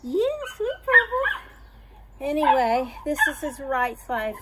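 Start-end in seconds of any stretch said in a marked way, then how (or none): 0.0-1.4 cooing. Real, not acted. Cooing at pet.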